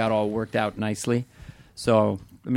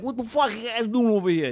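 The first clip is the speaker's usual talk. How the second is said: high-pitched comic Italian accent; hammed-up Italian wise guy, pitched high; 10 semitones higher than usual. Shown as a demonstration. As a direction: put on a broad Italian accent. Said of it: "like, an Italian accent".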